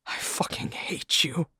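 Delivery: slow, soft hateful whisper; bitter whisper. Acted. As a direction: hiss it slowly through the hurt. Bitter words to fiancée.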